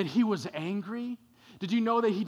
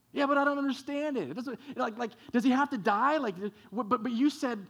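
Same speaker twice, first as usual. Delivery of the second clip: Martha pleading; 6 semitones higher, faster than usual. Acted. Martha's anxious plea to Jesus.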